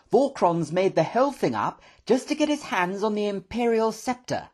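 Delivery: high-pitched, animated professor; excited. A narrator's reading. Professor conveying his brother's letter to the room, excited. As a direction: pour out the news excitedly. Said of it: "pacing round the room in excitement".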